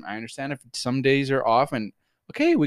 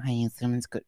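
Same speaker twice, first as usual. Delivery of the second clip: monotone imitation; 2 semitones lower, 6 dB quieter than usual. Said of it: "with that tone".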